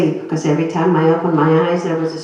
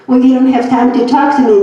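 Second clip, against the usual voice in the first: louder and higher, hurrying her off; urgent push; 6 semitones higher, 6 dB louder than usual. Retold or imitated. Doctor urging a patient to go ask about trials, loud and urgent. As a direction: send her away with urgency.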